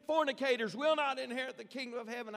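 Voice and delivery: high, loud fiery preacher's thunder; preaching like a maniac. Retold or imitated. Evangelist warning the crowd, a loud fiery preacher's cry. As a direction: hammer the sinners from the pulpit. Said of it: "began to preach like a maniac".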